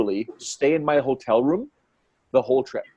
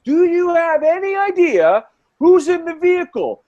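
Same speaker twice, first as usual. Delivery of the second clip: high, loud indignant tirade; mock yelling; 13 semitones higher, 4 dB louder than usual. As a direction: berate the cops at a high pitch, voice raised. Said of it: "he starts yelling at the cops".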